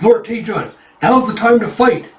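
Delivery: high, loud acted call to arms; urgent rallying cry. Soldier urging Lord Taejun to fight, urgent. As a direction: rouse your lord to battle.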